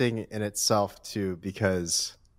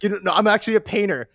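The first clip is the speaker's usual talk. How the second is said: higher, quicker mock-frustrated reply; imagined frustration; 10 semitones higher, faster than usual. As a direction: imitate someone getting mildly fed up, pitched up and hurried. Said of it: "you start getting frustrated".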